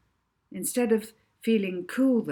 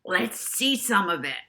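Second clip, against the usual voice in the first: host voice. A narrator's reading. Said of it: "said Charlie Chat".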